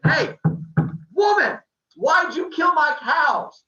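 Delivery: high, loud accusing shout; angry yelling. Acted. Apollo kicks Maya awake over killed cows, angry yell. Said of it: "they start yelling back and forth".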